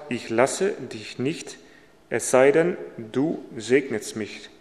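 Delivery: measured Bible reading voice, slowing on Jacob's words; solemn reading of scripture. Acted. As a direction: read the verses out steadily. Reads Bible passage aloud.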